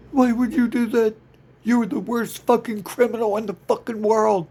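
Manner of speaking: high, loud, incredulous yell at a criminal; exasperated outburst. Acted. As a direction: blast them with disbelief.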